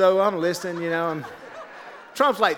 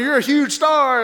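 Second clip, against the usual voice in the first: higher, louder mock politician; Donald Trump; 9 semitones higher, 6 dB louder than usual. Trump flatters [name] on the RV, loud impression. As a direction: gush like a showman praising a guest. Imitates Trump praising him.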